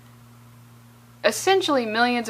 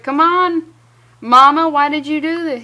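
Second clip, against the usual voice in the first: put-on higher, louder childish plea; whiny son; 4 semitones higher, 8 dB louder than usual. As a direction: beg Mama like a spoiled kid. Son pleading with mom.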